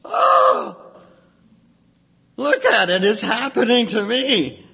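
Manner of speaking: high, breathless cry of alarm; panicked prayer. Retold or imitated. A frightened believer crying to God, high panicked voice. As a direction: someone seeing the disaster coming, pleading. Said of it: "that kind of prayer".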